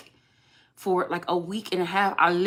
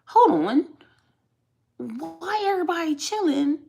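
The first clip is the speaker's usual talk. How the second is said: incredulous; 8 semitones higher, slower than usual. Retold or imitated. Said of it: "I'm getting a little bit like".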